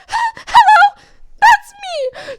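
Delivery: very high, loud, sing-song; high sweet voice. Retold or imitated. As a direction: put on an overly sweet hello. Teller voices herself.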